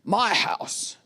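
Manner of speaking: mock declaration, punching the key words; hammed-up teenage independence speech; defiant eighteen-year-old. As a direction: make a big, indignant announcement. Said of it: "eighteen-year-old who's like".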